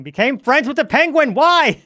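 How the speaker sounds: a loud, high wail of disbelief building up; storyteller's ending spoiled, loud and shocked; rising outrage. Real, not acted. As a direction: let it climb into a shocked yelp. Reacting to player's action.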